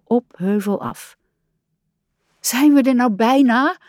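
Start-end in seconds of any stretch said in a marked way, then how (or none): 2.4-3.8 high, mildly puffed question; panting. A narrator's reading. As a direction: ask it as if a little out of breath. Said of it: "vroeg Kikker hijgend".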